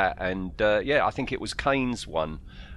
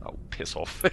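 muttered grumble; 10 dB quieter than usual. Retold or imitated. Grumbling reply to a volunteer.

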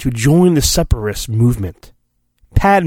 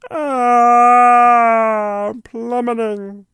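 mock falling cry; 8 semitones higher, much slower than usual. Narrating Jedi falling.

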